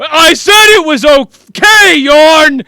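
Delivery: loud, high, drawn-out yell; fed-up outburst; exasperated shout. Acted. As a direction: cut through the wailing at full volume. Marquise finally snaps over the wailing.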